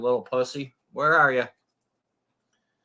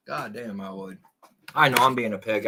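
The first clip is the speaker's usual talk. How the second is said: ordinary talk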